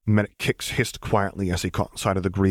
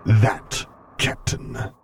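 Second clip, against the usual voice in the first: hissing whisper; 2 semitones higher, much slower than usual. A narrator's reading. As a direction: hiss it quietly, menacing. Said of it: "he hissed quietly".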